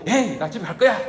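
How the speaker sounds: playful fake tantrum, half laughing; mock huff. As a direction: storm off in a pretend sulk. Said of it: "막 대들고 욕을 하고".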